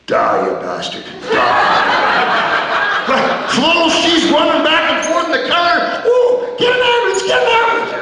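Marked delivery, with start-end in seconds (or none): 0.1-3.0 low gleeful curse; mock death wish. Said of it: "I'm thinking". 6.1-7.9 very high frantic cries; panicked waitress. Retold or imitated.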